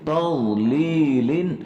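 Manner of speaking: drawn-out tarteel chant, each word held long; slow Quran recitation. Shown as a demonstration. Teacher reciting the verse slowly for students.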